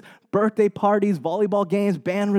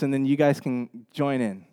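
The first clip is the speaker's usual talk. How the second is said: ordinary talk, nothing marked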